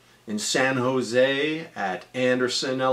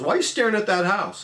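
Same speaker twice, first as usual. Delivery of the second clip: father's voice; 8 semitones higher, faster than usual. Retold or imitated.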